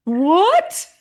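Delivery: high, loud astonished yelp; incredulous squeal, re-enacted; retold shock. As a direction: act out your stunned reaction, pitch shooting up. Her shocked reaction to the compliment.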